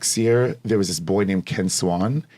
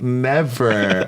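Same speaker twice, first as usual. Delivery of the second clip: higher, drawn-out playful "never"; mock emphatic refusal; 4 semitones higher, 3 dB louder than usual. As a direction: refuse mom with playful, retold drama. Retold emphatic refusal to mother.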